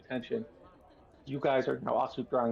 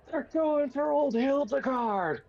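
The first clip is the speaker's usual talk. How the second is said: high-pitched shout from across the room; yelled alarm; 12 semitones higher than usual. Acted. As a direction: yell it out as a bystander raising the alarm. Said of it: "somebody yell out".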